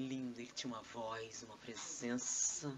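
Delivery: mock-awed, low soft gushing; terpesona berlebihan. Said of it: "como é que foi a palestra?".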